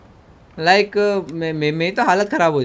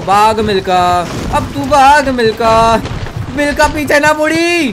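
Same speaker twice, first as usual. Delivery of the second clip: rousing shout; 7 semitones higher, 6 dB louder than usual. Real, not acted. Gamer cheering his runner like Milkha, rousing shout. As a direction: holler them on as they sprint.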